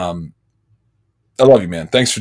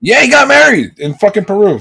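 indignant; 9 semitones higher than usual. Real, not acted. Indignant at missed wedding.

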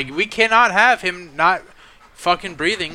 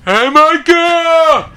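loud, high, long-drawn boxer holler; Rocky Balboa; 10 semitones higher, 8 dB louder, much slower than usual. Acted. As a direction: bellow the name like a movie boxer. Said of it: "a Rocky Balboa voice".

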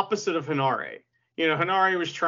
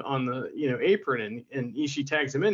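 usual speaking voice

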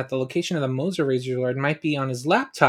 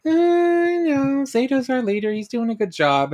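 mocking high voice; 10 semitones higher than usual. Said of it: "at first they were like".

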